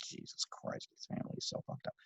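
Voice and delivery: unvoiced muttered comment; whispered aside. Real, not acted. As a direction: whisper it to yourself in disgust.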